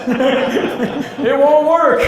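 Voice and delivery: put-on high, slow, laughing old-man quote; laughing imitation. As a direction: giggle through the investor's words, drawn out. Mimicking old investor with mouse.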